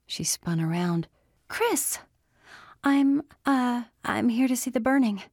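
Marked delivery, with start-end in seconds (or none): 1.5-5.2 high, flustered and caught out; startled, flustered. Acted. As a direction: blurt it out, caught off guard.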